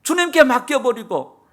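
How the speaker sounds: loud, high preaching climax; fiery preaching. Acted. Preacher urging the congregation to rely on the Spirit: full-force preaching. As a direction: drive the call home to the church.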